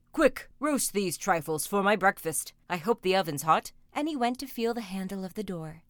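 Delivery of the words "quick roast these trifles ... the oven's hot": loud, house-shaking ogre voice; big ogre hailing his wife; booming ogre. A narrator's reading. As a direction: boom it out like a storybook giant. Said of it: "his voice shook the house".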